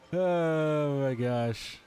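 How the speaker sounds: usual speaking voice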